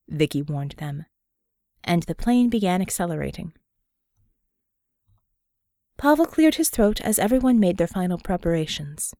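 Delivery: plain reading voice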